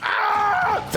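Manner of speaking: drawn high cry during play; real gamer squeal; high-pitched yelp. Real, not acted. Cries out during the game.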